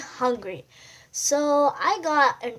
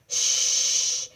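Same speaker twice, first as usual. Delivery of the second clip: shushing. Acted. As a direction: hush them all. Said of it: "says, say Mister Wolf's friends".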